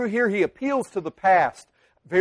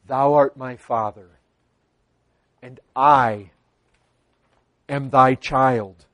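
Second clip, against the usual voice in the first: lowered pleading prayer; 7 semitones lower than usual. Retold or imitated. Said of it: "nothing I could say to God but this".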